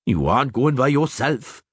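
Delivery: gruff firm dwarf voice. A narrator's reading. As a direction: state it, no arguing. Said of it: "Zoltan firmly stated".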